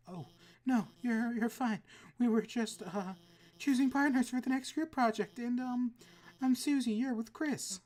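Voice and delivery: put-on shy sweet girl. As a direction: kind and a little flustered. Noelle voice again.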